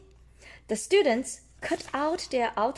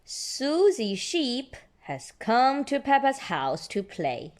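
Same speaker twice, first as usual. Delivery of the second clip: ordinary delivery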